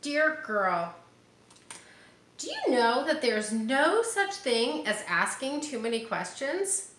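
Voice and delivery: put-on reading to children. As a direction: read it like a picture book to little ones. Reading picture book aloud.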